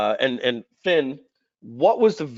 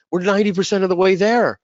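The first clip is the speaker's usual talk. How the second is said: higher, louder insistence on the point; pleading insistence; 6 semitones higher, 4 dB louder than usual. Retold or imitated. Telling partners the product is nearly done, insistent. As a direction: press the point hard. Begging partners not to restart.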